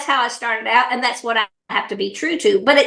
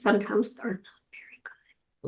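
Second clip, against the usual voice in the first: conspiratorial whisper, much softer; 4 semitones lower, 12 dB quieter than usual. Real, not acted. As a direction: lean in and whisper it like a guilty secret. Confiding an embarrassing truth.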